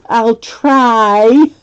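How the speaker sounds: put-on reluctant toddler. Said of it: "he says".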